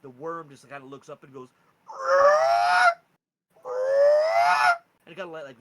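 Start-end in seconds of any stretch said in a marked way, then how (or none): 1.9-4.8 piercing high wyrm screech; loud, drawn-out creature wail; dragon cries. Acted. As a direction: cry out like a chained young dragon. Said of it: "lets out a couple different cries".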